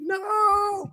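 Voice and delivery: very high, drawn-out mock "no"; hammed-up slow-motion panic; imitated movie scream. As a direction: stretch it into a high, helpless wail. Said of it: "he's like".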